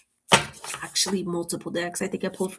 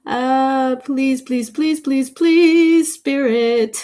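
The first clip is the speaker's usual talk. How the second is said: building plea; 4 semitones higher, 7 dB louder, much slower than usual. Pretend.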